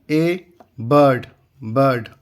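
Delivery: बर्ड drawn out as "bud", falling; slow model reading. Shown as a demonstration. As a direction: stretch बर्ड slowly like a teacher modelling. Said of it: "teacher models reading".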